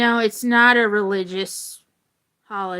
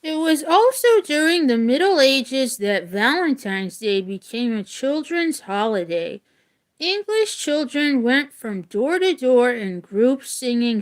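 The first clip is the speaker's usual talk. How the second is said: steady read-aloud voice, a touch livelier than chat; reading a story aloud; 4 semitones higher, 4 dB louder than usual. Acted. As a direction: read the legend clearly to the class. Teacher reads story to class.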